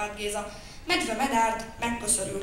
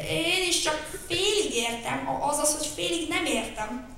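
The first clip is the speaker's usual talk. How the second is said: unsure; 6 semitones higher, 4 dB louder than usual. Acted. Cheetah admits confusion, hesitant. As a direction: stumble over it uncertainly. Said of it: "bizonytalankodott Gepárd Géza".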